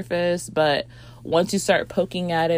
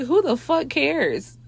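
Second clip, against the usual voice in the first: incredulous; 9 semitones higher, slower than usual. Retold or imitated. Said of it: "I was like".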